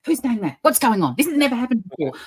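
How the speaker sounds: high, alarmed voice of a startled peacock; panicked peacock. Retold or imitated. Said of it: "And he was going".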